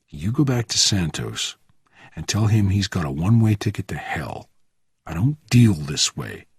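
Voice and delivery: low-key, controlled threat; Bourne, menacing. Acted. Bourne threatens the gripped messenger, quietly menacing. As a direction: menace held quietly, no shouting.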